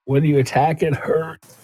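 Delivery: high, plaintive in-character warning; mock-pained, high little voice; hurt character. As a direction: play a wounded character, pitched well up. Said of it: "as blood trickles down his nose".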